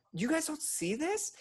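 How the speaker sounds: very high, drawn-out disbelieving question; retold incredulous appeal. Retelling disbelief at bystanding coworkers.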